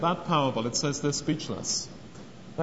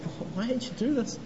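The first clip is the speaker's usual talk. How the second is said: higher and softer, a sorrowful plea; the Lord gently asking why, full of sadness; 8 semitones higher, 4 dB quieter than usual. Retold or imitated. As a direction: ask it with grieving disappointment, not anger. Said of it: "But with sadness".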